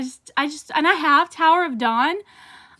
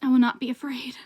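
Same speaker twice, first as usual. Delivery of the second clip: breathless whispered vow; 4 dB quieter than usual. Acted. Vlogger whispering a line from the book. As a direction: whisper it like a private oath.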